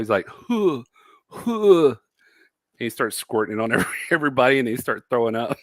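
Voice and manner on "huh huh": high, heaving fake vomit noise; mock throw-up sound, pitched way up; imitated retching. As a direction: imitate a big theatrical retch. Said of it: "the throw up noises".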